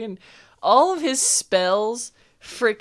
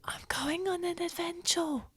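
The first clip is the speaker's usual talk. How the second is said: hushed, higher character voice; secretive young adventurer; 6 semitones higher, 8 dB quieter than usual. Acted. Adventurer confiding in a child about going away, hushed and higher. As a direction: confide softly, partly whispering.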